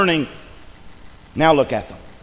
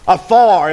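"Afar" said louder with a falling tune; stressed afar; 6 dB louder than usual. Shown as a demonstration. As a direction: land hard on "Afar". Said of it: "what's that next word say?".

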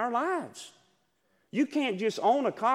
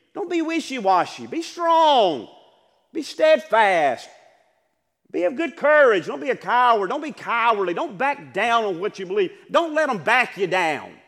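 Moses charging the people, loud and firm; voicing a leader's charge to stand firm, louder; rousing charge; 5 semitones higher, 6 dB louder than usual. Preacher voicing Moses urging the people, a loud rousing charge. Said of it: "He said".

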